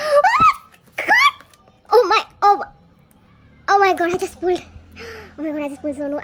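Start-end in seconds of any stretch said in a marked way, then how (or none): 0.1-4.6 high, loud squeal of disbelief; shriek, then gush loudly over a lucky win; real overjoyed outburst; thrilled shriek. Real, not acted. Just pulled the wanted card.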